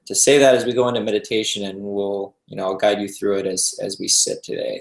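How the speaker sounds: ordinary delivery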